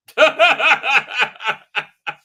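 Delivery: loud, high burst of laughter; long rattling laugh; real laugh. Real, not acted. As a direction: crack up at your own joke. Cracks up at own intro.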